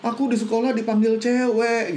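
lightly acted tearful complaint; imitated tearful child. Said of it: "anaknya sambil nangis bilang".

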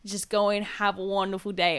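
lightly put-on New York accent; New Yorker accent. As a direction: imitate a friendly New Yorker helper. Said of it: "trying to put on a New Yorker accent".

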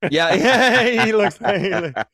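high, loud laugh through the words; helpless, loud laughing reply; laughing hard. Real, not acted. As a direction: crack up mid-sentence. Cracking up at a lookalike remark.

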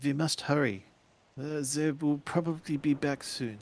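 urgent foreign woman. Acted. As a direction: urge them to hurry, worried. GM voices Greta.

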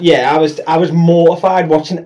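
usual speaking voice

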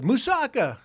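a loud, high mock Greek cry in a hammed-up Anthony Quinn accent. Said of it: "best Anthony Quinn accent".

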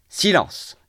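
high, sharply falling cry for silence; exclaimed. A narrator's reading. Ferfitchkin hushing the table before a speech, crying out. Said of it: "s'écria Ferfitchkin".

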